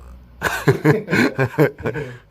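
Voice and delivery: genuine, amused belly laugh; real hearty laugh. Real, not acted. Host reacting to guest's blunt farewell to his ex, laughing. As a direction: burst out in loud, high laughter.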